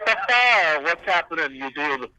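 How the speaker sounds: tinny, distant mic sound; like talking through a tin can; tin can phone. Real, not acted. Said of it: "You sound like you're talking through a tin can".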